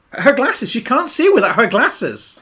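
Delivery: hammed-up dismay; mock distress. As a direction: protest as if it's a tragedy. Mock outrage at lost glasses.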